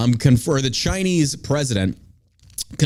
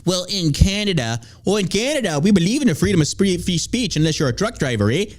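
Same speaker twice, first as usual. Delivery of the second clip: higher-pitched mock sanctimonious Canadian politician; lampooning a self-righteous leader; mock moral high ground; 5 semitones higher than usual. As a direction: put on a preachy Canadian "eh" voice. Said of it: "pretended to, like, take the moral high ground".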